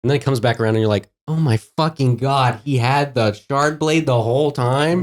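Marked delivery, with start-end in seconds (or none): 1.3-5.0 drawn-out, higher-pitched disbelief; astonished reader. Acted. Reader reacting to the shard blade twist, astonished. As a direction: stretch out the shock of a sudden realization.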